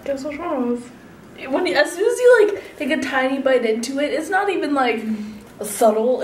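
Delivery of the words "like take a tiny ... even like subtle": ordinary delivery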